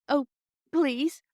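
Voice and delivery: put-on high, tearful little girl's plea; begging through sniffles; sniffling plea. A narrator's reading. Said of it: "sniffed Bobby".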